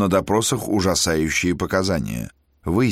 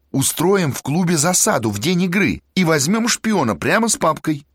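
high, eager planning; eager student; 7 semitones higher than usual. Acted. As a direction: lay out the plan keenly. Student lays out plan.

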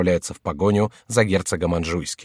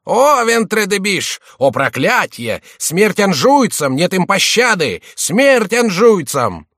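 high, loud, slow battle cry; menacing shout; 10 semitones higher, 7 dB louder, slower than usual. A narrator's reading. Jester shouting menacingly while beating the favourites. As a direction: roar it like a war cry. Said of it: "кричал грозным голосом".

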